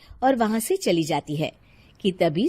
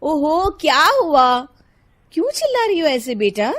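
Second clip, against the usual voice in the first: higher, mildly exasperated reply; exasperated mother; 7 semitones higher, 7 dB louder than usual. Acted. Mother asking her daughter why she shouts, exasperated. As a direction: answer the shouting child with impatience.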